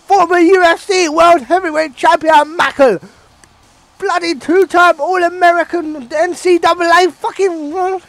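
excited ringside commentator voice; J B O impression. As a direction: gush over the beast like a hyped announcer. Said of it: "I'm gonna do my best J B O impression".

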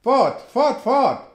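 high, loud repeated "fort"; shouting crowd. A narrator's reading. Apache warriors shout to the narrator, high and loud. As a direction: yell it as a wild crowd. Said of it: "schrien sie".

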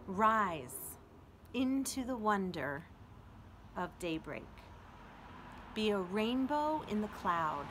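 slow, uplifting poem recital; poem reading. Acted. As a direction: read it as a gift of encouragement. Reads poem Majestic aloud.

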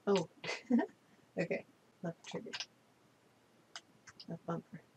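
ordinary talk, nothing marked

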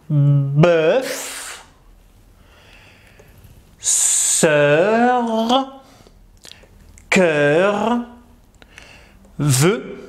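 vowel of "bœuf, sœur, cœur, vœu" drawn out; slow, isolated model words with long vowels; sound demonstration. Shown as a demonstration. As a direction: stretch each word to show its eu sound. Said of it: "mieux pouvoir lire le son".

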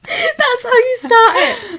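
high, loud, drawn out through laughter; real helpless laughter. Real, not acted. Teasing partner about the opening, crying with laughter. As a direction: crack up while saying it.

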